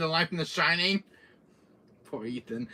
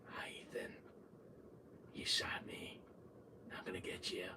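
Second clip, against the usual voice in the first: hushed villain whisper; very soft stalker menace; creepy taunt; 8 semitones lower, 20 dB quieter than usual. Retold or imitated. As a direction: whisper the threat, barely audible. Villain taunts player from hiding.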